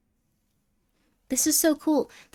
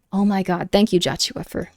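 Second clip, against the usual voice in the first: usual speaking voice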